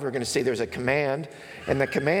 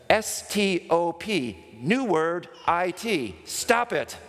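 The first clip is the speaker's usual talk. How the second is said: slow, high, letter-by-letter spelling; mock-exasperated counselor, ending on a high outburst; emphatic spelling out; 4 semitones higher, slower than usual.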